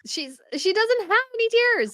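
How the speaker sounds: very high, incredulous wail; exasperated whine. Real, not acted. Host objecting to tearless crying on screen, exasperated whine.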